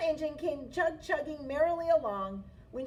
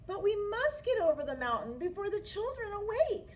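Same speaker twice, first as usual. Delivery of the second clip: higher urgent toys; toys urging; 8 semitones higher than usual. A narrator's reading. Toys urging the little engine before dawn, higher. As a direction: press her to hurry. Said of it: "said all the dolls and toys".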